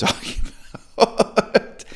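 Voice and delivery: real laugh interrupting the words; breaking into laughter. Real, not acted. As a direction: laugh bursting through the last words. Laughs at his own point.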